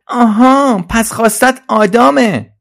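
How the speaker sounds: high, loud cry of discovery; loud shout. Acted. Trolley suddenly works out what Suzi wants, high shout. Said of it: "با صدای بلند داد زد".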